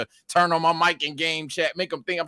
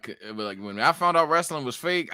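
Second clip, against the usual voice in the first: ordinary talk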